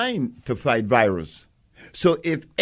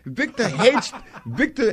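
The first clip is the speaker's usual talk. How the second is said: laughing; 9 semitones higher than usual. Real, not acted. Mocking his rival Victor Herbert.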